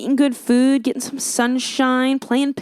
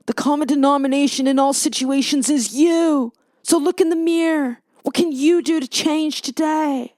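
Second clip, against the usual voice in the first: higher, hammed-up pep talk; mock motivational speaker; 5 semitones higher than usual. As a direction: parody a motivational speaker rallying the crowd. Mocks motivational preaching, then "sounds motivating.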